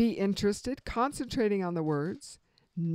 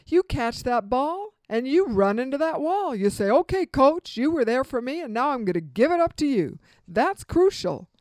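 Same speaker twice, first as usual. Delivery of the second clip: fervent quote; 4 semitones higher, 8 dB louder than usual. Retold or imitated. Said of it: "Michael Irvin said".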